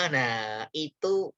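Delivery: usual speaking voice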